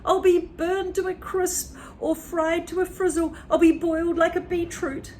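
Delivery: put-on panicked old woman; frantic granny. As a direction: moan in alarm. Grandma panics.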